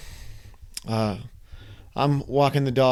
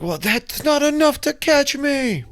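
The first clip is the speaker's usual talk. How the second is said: scared thought; 12 semitones higher, slower than usual. Retold or imitated. Staring down at shallow river.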